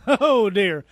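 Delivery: ordinary talk, nothing marked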